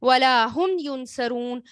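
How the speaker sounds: slow, measured recitation of the verse; Quranic recitation. Shown as a demonstration. Teacher recites the verse to students, slow and measured. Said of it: "reciting the verse".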